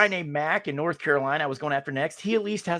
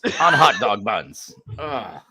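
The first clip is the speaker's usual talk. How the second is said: slow, louder, disgusted; mock disgust; 6 dB louder, much slower than usual. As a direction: drag it out in revulsion. Disgusted repeat.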